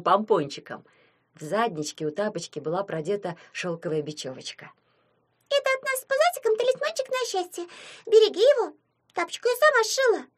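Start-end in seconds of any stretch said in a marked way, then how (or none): 5.5-10.3 very high, piping cartoon voice; squeaky little imp. A narrator's reading. Imp giving Anya a homemade charm, high proud squeak.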